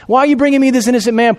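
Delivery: can't believe what he's being handed; incredulous Pilate. Acted. Pilate demanding why the accusers bring an innocent man, disbelieving.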